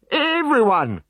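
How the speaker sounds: high, loud urgent orders; rally the village at once; high loud bossy command; commanding chief. A narrator's reading.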